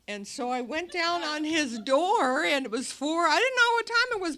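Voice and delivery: normal talking voice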